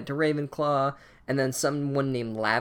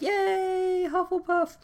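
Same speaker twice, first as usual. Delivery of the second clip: much higher, squeaky sarcastic crowd cheer; a fake-happy falsetto hooray; mock cheer; much slower than usual. Students mock-cheering a Hufflepuff sorting, high squeaky cheer.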